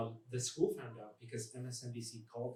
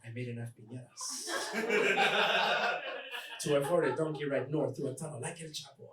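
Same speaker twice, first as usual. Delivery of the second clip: hammed-up Mexican accent, straight-faced; fake Mexican accent. Prankster explaining how he got to America, fake Mexican accent. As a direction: tell a tall tale in a put-on Mexican accent. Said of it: "We know your accent's fake".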